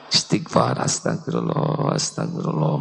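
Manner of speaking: very low, breathless whisper of astagfirullah; whispered istighfar. Shown as a demonstration. Preacher demonstrates istighfar to the audience, low whisper.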